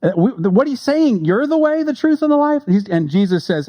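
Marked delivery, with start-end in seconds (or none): 1.2-2.6 much higher, eager voicing of the crowd; listener's reply. The crowd answering Jesus, high and eager. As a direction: pitch it up as the astonished hearers.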